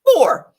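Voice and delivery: Four punched high and loud; stress on Four. Real, not acted. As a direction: hit Four hard. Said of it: "I have four exes".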